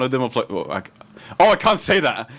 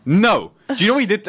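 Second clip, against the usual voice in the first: mock indignant girl; 9 semitones higher than usual. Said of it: "She goes".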